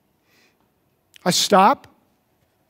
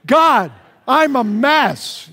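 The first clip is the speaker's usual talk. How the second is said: louder, slow and emphatic, like you mean it; with enthusiasm; 4 semitones higher, 4 dB louder, much slower than usual. Shown as a demonstration. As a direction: proclaim it with excitement. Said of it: "Let's say it like we mean it".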